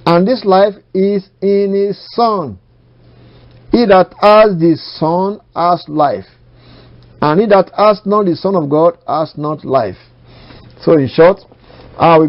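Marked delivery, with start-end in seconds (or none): 0.0-10.0 high, slow, measured proclamation; scripture recitation. Real, not acted. Preacher reading that life is in the Son, declaimed. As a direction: declaim each phrase of the verse deliberately.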